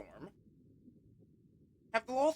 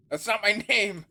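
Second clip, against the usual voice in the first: indignant shout; 5 semitones higher, 10 dB louder than usual. Acted. Character objects to nickname Pinky.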